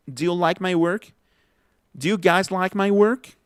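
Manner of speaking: "Do you" said as "d'you" in a rising question; contracted do you. Shown as a demonstration. Teacher showing how it would be, contraction demo. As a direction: merge Do and you, keep work as "werk". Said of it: "Así sería".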